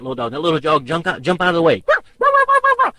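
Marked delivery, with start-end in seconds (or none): 1.9-2.9 very high, loud yapping chihuahua; shrill chihuahua barks; tiny dog yapping. Retold or imitated.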